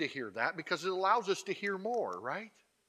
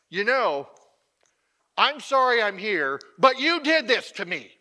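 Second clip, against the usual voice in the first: loud apology rising into blame; accusing non-apology; 5 semitones higher, 10 dB louder than usual. Acted. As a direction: turn the sorry into a loud accusation. Acted blaming non-apology.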